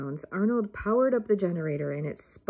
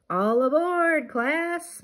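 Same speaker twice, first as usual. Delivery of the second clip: high cheerful shout; rally the class aboard; 8 semitones higher, 3 dB louder, much slower than usual. A narrator's reading. Teacher calling class aboard, cheerful shout. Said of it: "Miss Frizzle shouted".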